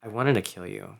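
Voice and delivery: low, quiet villain threat; quietly menacing film villain; heartless villain. Acted. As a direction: deliver it low and hushed, as a ruthless villain. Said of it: "heartless ones when I was doing some films".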